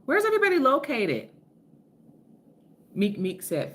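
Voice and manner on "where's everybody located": calling out. Real, not acted. Calling out to viewers.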